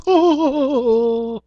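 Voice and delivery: high silly clown laugh; clown giggle. Acted. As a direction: cackle like a goofy clown. Clown laugh after greeting.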